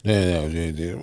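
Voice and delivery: deep, soft seductive murmur; low sexy voice. Pretend. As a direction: go low and smooth to charm her.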